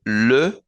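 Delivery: le drawn out with rounded E; slow le with rounded lips; French article le. Shown as a demonstration. Teacher isolating the singular article. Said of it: "singular se pronuncia le".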